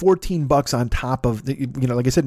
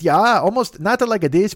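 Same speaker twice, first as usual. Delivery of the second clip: put-on Swedish accent; 7 semitones higher, 3 dB louder than usual. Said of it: "make fun of me for doing this fucking voice".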